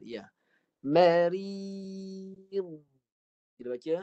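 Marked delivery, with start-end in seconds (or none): 0.8-2.5 Marid recited with the long vowel drawn out and dha stopped; teacher chants Marid, holding the long i; recited demo. Shown as a demonstration. Teacher showing students the long vowel, recited. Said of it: "dha dengan huruf mad".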